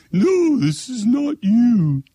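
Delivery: slow, high-pitched mimicry of a cook; mock the agent as Julia Child, high and drawn out; Julia Child impression. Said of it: "That's the way she talked".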